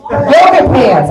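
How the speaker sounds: high, loud and slow triumphant recall; eureka. Real, not acted. Comic remembering the name of the pants, triumphant. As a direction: land the word like you finally found it.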